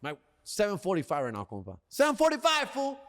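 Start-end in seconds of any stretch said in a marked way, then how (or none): 1.9-3.0 high-pitched, excited exclamation; excited outburst. Real, not acted. Comic boasting to his audience about a seven forty-five, excited. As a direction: blurt it out high and thrilled.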